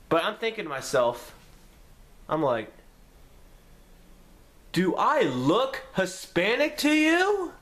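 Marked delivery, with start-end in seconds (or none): 4.7-7.5 sarcastic. Retold or imitated. Narrator's inner retort to cops.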